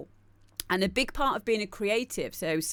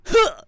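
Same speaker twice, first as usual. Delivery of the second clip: mock grunt; 14 semitones higher, 10 dB louder than usual. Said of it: "the accent is like".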